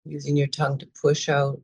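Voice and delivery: calm, measured meditation guide, with long pauses between phrases; guided meditation. Real, not acted. Teacher guiding listeners to watch their thoughts and breath, calm meditation voice. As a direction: ease them into noticing breath and body, unhurried.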